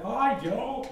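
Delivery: high-pitched sing-out hello; imitated friendly greeting. Imitating every other friendly Filipino, high cheery greeting.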